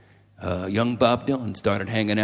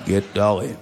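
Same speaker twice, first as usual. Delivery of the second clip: low, lightly imitated insistent sick man; ailing old friend; 4 semitones lower, 3 dB louder than usual. Dying man insists on his request to his friend, lightly voiced low. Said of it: "He's a dying man".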